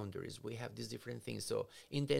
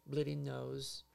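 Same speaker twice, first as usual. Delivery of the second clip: ordinary talk, nothing marked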